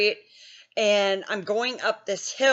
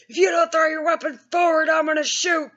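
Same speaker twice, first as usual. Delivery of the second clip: shouted high warning from a cop; mock police shout; 6 semitones higher than usual. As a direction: bark the threat like a cop. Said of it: "He was like".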